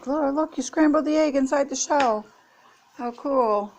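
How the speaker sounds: a hint of marvelling children; lightly voiced excited kids. Kids cracking eggs at grandma's, lightly imitated wonder. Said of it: "let them crack it and say".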